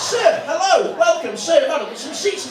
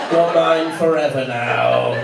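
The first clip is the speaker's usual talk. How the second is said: low, slow creepy whisper of a lover; 9 semitones lower, slower than usual. Acted. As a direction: purr it like a possessive creep. Voicing Mark's creepy spooning line.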